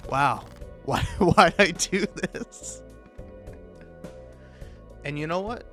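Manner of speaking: ordinary talk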